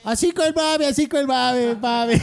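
street vendor call. Retold or imitated. Friend imitating hawking in the street, playful calling out. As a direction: sing out the product with a grin. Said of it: "Y luego pasaba".

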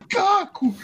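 high mock yell. Imitating enraged kid.

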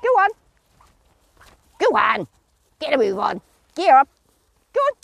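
loud, sharp urging; calling out. Real, not acted. Trainer geeing up her mare, loud sharp call. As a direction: wake the lazy horse up with a loud call.

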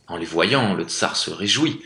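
usual speaking voice

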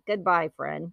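imitating a theme-park robot; robot goodbye. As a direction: do the animatronic ET signing off.

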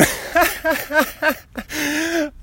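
long delighted high cackle; high amused laugh. Real, not acted. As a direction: let the laugh run, high. Mail guy tossing paper.

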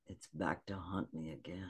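low, very soft deadpan quote. Retold or imitated. Guest quoting Vincent on the biscuit's return, deadpan. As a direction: drop it low and quiet, utterly deadpan. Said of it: "He's just so deadpan".

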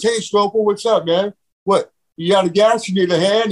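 a hint of a cocky surfer ribbing a pal; teasing friend. Passing friend teases the stranded driver, lightly voiced mock offer. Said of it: "Scott Dunlap pulls up and goes".

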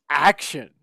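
ordinary delivery

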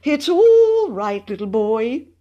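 high, drawn-out humouring coo; anxiously soothing. A narrator's reading. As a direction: coax a child in a high, stretched voice. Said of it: "he said soothingly".

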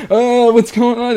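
put-on high, drawn-out clueless kid voice; mock bewilderment. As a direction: play dumb in a slow, high whine. Acting out his bewildered younger self.

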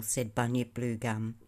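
ordinary delivery, nothing marked

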